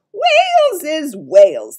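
very loud, high, drawn-out insistence; exasperated giant. A narrator's reading. Giant overruling Sophie's correction, loud and slow. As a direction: boom it out slowly, high and loud.

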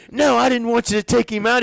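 high, loud protest; insist, getting worked up; a coach getting a little upset; mock upset.

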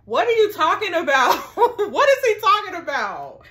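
loud, high and incredulous, breaking into a laugh; loud disbelief. Real, not acted. Reacting to the boyfriend's reply with loud shocked disbelief. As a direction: demand an answer in shocked disbelief.

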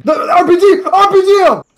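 panicking gamer. Real, not acted. Frantic gameplay shouting.

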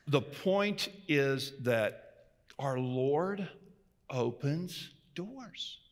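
slow, softened "our Lord opens doors"; solemn emphasis. Acted. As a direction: lay the point down slowly and reverently. Slow solemn key point.

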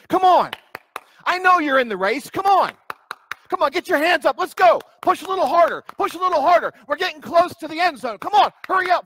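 loud, high coach yelling at players; football coach. Retold or imitated. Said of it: "It sounds like my football coach".

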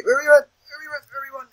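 high-pitched chant trailing away; high squeaky repeating. Pretend. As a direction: pitch it way up and repeat, dropping in volume. Panicked repeating in game.

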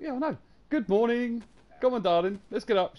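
high, loud, mock-chirpy good morning; hammed-up cheerful husband at dawn; chirpy wake-up. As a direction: tease her awake with over-the-top cheer. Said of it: "I'm like".

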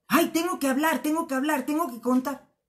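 high, loud mock-eager chatter; imitating an eager need to speak; mock urgency. Mocks ego's urge to talk.

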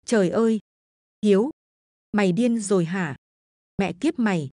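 mild horrified yell; understated outraged cry; shouting in shock. A narrator's reading. Hận seeing his friend butchered, a mild horrified yell. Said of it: "thì cậu gào lên".

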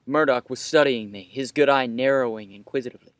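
ordinary talk

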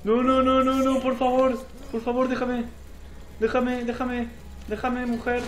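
high, loud frantic begging; panicked pleading. Real, not acted. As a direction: plead loudly to be left alone. Begging the attacker to stop. (súplica aguda, a gritos, con pánico)

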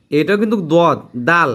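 usual speaking voice

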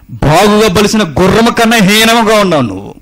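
loud, high, urgent sermon warning; fiery preaching. Acted. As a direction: thunder the warning at the congregation. Preaching at full force. (గట్టిగా హెచ్చరించే ప్రసంగం)

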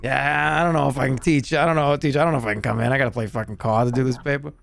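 put-on Boston accent, making excuses; hammed-up Boston student; forty-year-old Boston guy. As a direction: fob off the teacher like a middle-aged Bostonian. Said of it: "like a forty-year-old guy from Boston".

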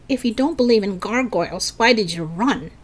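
usual speaking voice

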